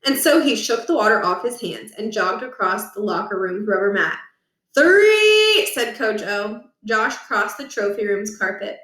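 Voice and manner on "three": countdown shout. Acted. Coach counting down.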